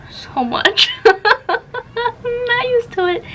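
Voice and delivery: real laugh spilling into the words, drawn out; high, giggly admission. Real, not acted. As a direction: break into a high giggle and talk through it. Laughing over her heavy reading load.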